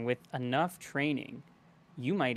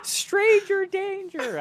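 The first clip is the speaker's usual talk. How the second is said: very high, loud alarm cry; acted yell; 7 dB louder, much slower than usual. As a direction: shriek it like a child sounding the alarm. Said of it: "I think I yell".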